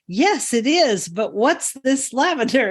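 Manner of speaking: high, drawn-out imitation of a puzzled visitor; insistent visitor. Tour visitor who misunderstood the name, imitated as insistent.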